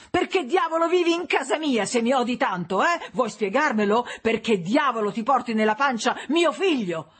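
high, heated, accusing shout; yelling in anger. A narrator's reading. Husband circling his pregnant wife, yelling accusations. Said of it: "mentre urlava, tremando tutto e ansimando".

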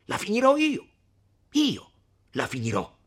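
high, loud angry vow; furious outburst. Acted. Renzo cutting the women off, furious. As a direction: burst out and swear to end it. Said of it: "interruppe Renzo".

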